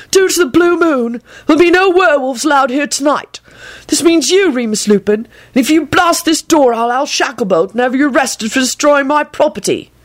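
high-pitched indignant proclamation; sign read aloud. A narrator's reading. Aberforth's warning read out to Lupin, indignant proclamation.

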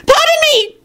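very high, shrill furious official; shrill it out in fury; furious shrill witch. A narrator's reading. Undersecretary forced to say his title, shrilling furiously. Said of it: "the woman shrilled furiously".

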